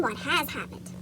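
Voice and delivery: plain reading voice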